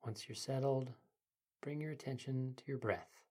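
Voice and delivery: soft, quiet meditation guide; sleep story narrator. Acted. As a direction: ease the listener into calm.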